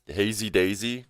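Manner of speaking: usual speaking voice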